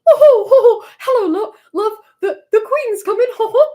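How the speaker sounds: high, loud, hammed-up bad British impression; Scar doing Mumbo. Streamer mocking a friend's impression of Mumbo, high hammy voice. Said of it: "an impression of Scar doing an impression of Mumbo".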